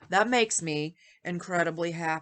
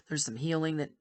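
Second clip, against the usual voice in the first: ordinary delivery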